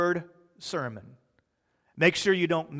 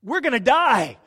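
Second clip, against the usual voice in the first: much higher, louder mock alarm; hammed-up panic; 7 semitones higher, 5 dB louder than usual. Disciples waking Jesus in the storm, hammed-up panic. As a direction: play the terrified disciples, over the top. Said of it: "They're in a panic and they're like".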